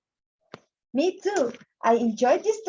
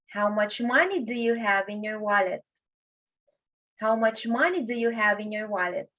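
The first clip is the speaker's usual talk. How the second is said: MONEY raised, then falling away to WALLET; falling intonation. Shown as a demonstration. Teacher demonstrating a wh-question for grade-one kids, falling intonation. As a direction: let the pitch drop after MONEY and stay down through WALLET. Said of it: "in a falling intonation".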